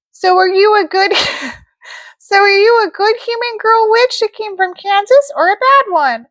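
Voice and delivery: high, sweet fairy voice; sing-song good witch; Glenda the good witch. Acted. Glenda, laughing through.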